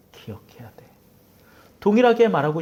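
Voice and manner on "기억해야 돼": trailing off into a breathless whisper; fading whisper. Acted. Preacher closing the quote, whispering the last reminder. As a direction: let it die to a whisper. Repeated plea fades to whisper.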